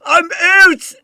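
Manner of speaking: high, loud mock horse voice, cheerfully quitting; horse bolting off. A horse ditching its carriage, shouting a gleeful farewell.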